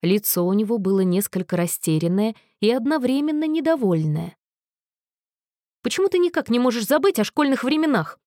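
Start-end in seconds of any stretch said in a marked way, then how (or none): 5.9-8.2 огрызнулся. A narrator's reading. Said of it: "огрызнулся он".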